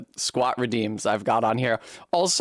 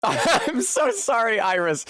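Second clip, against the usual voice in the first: laughing; 8 semitones higher than usual. Real, not acted. Laughing apology to viewer.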